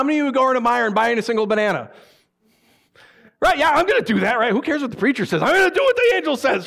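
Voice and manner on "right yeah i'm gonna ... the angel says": mock eager. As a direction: gush like a believer in on the joke.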